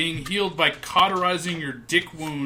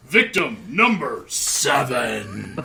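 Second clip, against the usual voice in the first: low, drawn-out body-count announcer; mock announcer; 6 semitones lower, much slower than usual. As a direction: intone it like an ominous tally. Mock death-count announcement in banter.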